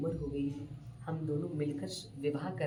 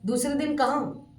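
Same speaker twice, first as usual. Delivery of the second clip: excited correction; 8 semitones higher, 11 dB louder than usual. Retold or imitated.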